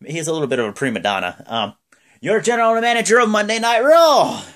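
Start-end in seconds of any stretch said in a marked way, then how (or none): 2.2-4.4 high, loud hammed-up announcement; Vince. Boss naming the general manager, big announcer yell. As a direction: bellow it like a showman boss. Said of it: "And Vince is like".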